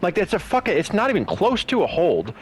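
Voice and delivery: exasperated rant. Real, not acted. Ranting about bad officiating.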